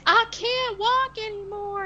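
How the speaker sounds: put-on high, weak whimper of a girl who can't go on; put-on high feeble voice. Retelling the movie, a put-on high feeble voice. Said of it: "She doesn't sound like that".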